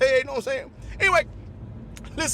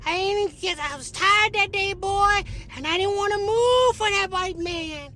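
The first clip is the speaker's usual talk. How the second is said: Rosa Parks voice, higher and slow, scolding; slow scolding old lady, higher pitched; 5 semitones higher than usual. Retold or imitated. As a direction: play a stern old woman dressing him down. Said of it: "She said".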